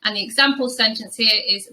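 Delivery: ordinary talk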